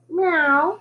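high, drawn-out meow; cat's cry. A narrator's reading. Cat greets the new boy, crying meow. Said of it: "the cat cried".

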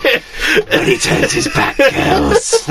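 high, slow whispery witch plotting; witchy cackle voice. Retold or imitated. Cleaners plotting like witches round a cauldron, high witchy whisper.

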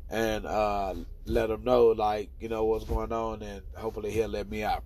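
ordinary talk, nothing marked

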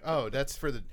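ordinary delivery

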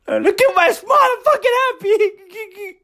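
high, strained mock-cheerful voice; forced happy. Man denying he has been crying, forced happy. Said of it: "I'm very happy".

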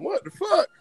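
high disbelieving what the fuck; outraged. Real, not acted. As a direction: toss it up in disbelief. Mock outrage at bad list.

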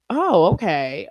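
mock shocked reaction; surprised oh okay. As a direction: act out a listener caught off guard. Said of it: "y'all would have been like".

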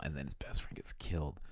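breathy hushed reveal, no voice; dramatic whisper. Real, not acted. Telling a friend the plot twist, whispered.

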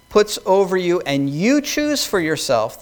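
ordinary talk, nothing marked

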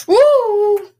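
loud, high, sustained woo; excited whoop. Real, not acted. Gamer reacting to the play on screen, loud whoop. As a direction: let out a big high whoop.

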